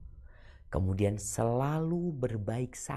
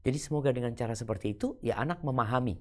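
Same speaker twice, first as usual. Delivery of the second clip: ordinary delivery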